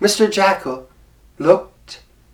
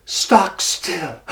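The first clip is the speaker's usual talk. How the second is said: ordinary delivery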